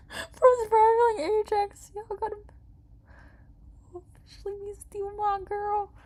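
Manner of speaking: high, laughing through the words; real laughter lifting the voice high. Real, not acted. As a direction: giggle while reading the news. Laughing at game news.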